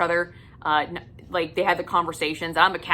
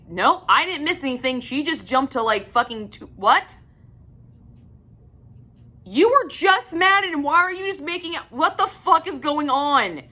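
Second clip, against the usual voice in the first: exasperated disbelief; 7 semitones higher than usual. Retold or imitated. Reader ranting at a confusing book, higher and exasperated. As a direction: climb higher as the confusion turns to outrage. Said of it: "I'm like".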